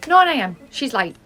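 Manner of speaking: loud, pointed announcement of lateness; sharp, loud reprimand; indignant scolding. Acted. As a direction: call her out loudly. Scolding a late colleague.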